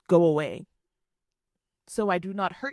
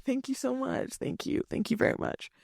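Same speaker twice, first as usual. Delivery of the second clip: soft, near-whispered thank-yous, backing away; hushed awkward thanks; 5 semitones higher, 7 dB quieter than usual. Retold or imitated. To a rude date, a hushed awkward thank you. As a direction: murmur the thanks, barely voiced.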